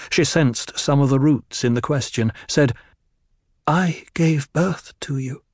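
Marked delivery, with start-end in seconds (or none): 3.6-5.4 calm answer. A narrator's reading. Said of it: "the precisely correct answer to calm him".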